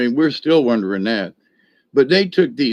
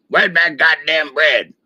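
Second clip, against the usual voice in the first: imitating the Sarge; 2 semitones higher than usual. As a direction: do the Sarge grumbling about his bread. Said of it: "He talked like that for real".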